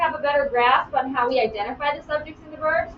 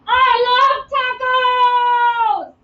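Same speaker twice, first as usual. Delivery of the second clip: sung out, much higher, louder and very slow; exclaimed; 8 semitones higher, 5 dB louder, much slower than usual. Shown as a demonstration. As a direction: belt "I love tacos!" with big joy. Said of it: "end in an exclamation point".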